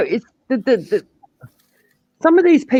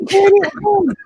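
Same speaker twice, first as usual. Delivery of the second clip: much higher, louder put-on voice of an exasperated man; mock exasperated accuser; 7 dB louder than usual. Maku accusing her of using slang, high and louder. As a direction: squeal it like he caught her again.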